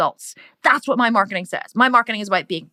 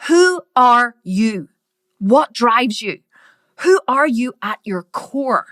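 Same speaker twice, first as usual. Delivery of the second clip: slow probing; slower than usual. Real, not acted. Direct challenge to viewer.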